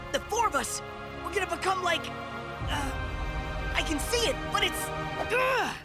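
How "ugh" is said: high "ugh" of exasperation; frustrated groan. Acted. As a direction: groan as the words fail you. Frustrated at losing his words.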